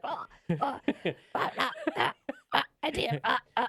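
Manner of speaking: high mocking Boston accent, laughing; hammy Boston accent through laughter. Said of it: "your Boston accent".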